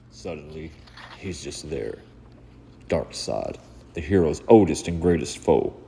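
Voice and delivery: intense, driving story narration; dramatic narration. A narrator's reading. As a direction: read it like a brooding comic-book voiceover.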